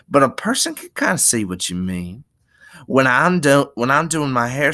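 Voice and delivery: a hint of chatty Southern woman; friendly Curly's wife. Curly's wife chatting to Lenny, friendly.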